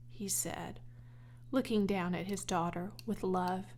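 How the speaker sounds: usual speaking voice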